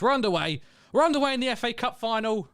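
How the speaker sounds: high, loud announcement, building on the repeat; excited kick-off call. Real, not acted. Streamer announcing cup final kick-off to viewers, real excitement.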